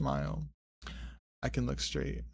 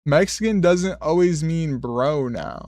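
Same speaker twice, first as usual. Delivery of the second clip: repeated louder and slow, word by word; 3 semitones higher, 7 dB louder than usual. Real, not acted. After a puzzled "what", repeating it louder and slow. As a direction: make them hear it this time.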